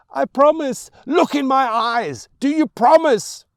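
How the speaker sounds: loud, high intense oath; shouted demand. Acted. Mother forcing her son to swear, shouting. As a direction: hammer every word at him.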